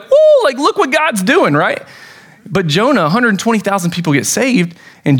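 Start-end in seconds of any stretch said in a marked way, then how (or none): 0.0-1.5 a high, loud whoop of joy; cheering like a fan at a game; pumped up. Retold or imitated. Youth leader reliving his joy at a student saved, excited whoop. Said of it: "I was pumped up".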